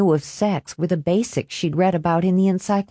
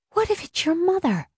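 worried; 11 semitones higher than usual. Acted. She fears mother upstairs.

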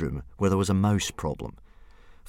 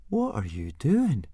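curious; 6 semitones higher, slower than usual. Acted. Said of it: "asked Fishlegs curiously".

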